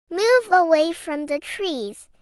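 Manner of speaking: ordinary delivery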